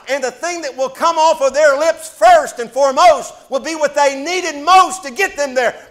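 loud, high, building preacher's cry; preaching at full force. Acted. Preacher crying out to the congregation, loud and climbing. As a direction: whip the congregation up to a shout.

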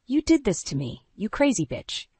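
heated, but not shouted; spat accusation. A narrator's reading. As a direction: throw the blame with mounting outrage. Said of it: "he spat, his hands curling".

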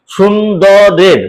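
সুন্দরের spoken very slowly, each syllable drawn out; drawn-out word. Shown as a demonstration. Teacher slowly sounding out সুন্দরের for metre. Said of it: "রে রে দু মাত্রা হবে".

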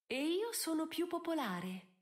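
high, one-upping comeback; retort. A narrator's reading. Lady Bridgerton outdoing the countess, retorting. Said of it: "ribatté Lady Bridgerton".

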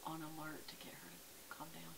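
hushed explanation; whisper. Real, not acted. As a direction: keep it low so she calms. Whispering near stressed dog.

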